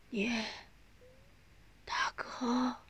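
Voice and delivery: very soft dying whisper; faint, weak voice. A narrator's reading. Dying mother calls for eldest son, faintly. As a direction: barely get the words out. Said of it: "用微弱的声音说".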